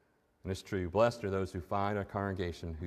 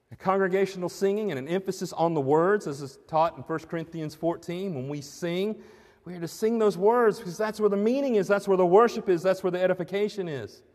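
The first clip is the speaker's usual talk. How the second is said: higher, louder preaching voice; full-force preaching; 8 semitones higher, 6 dB louder than usual. Acted. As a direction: drive the point home to the congregation.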